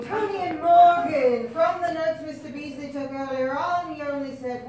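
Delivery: ordinary talk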